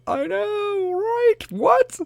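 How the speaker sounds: high, loud excited squeal. Acted. Excited mock disbelief.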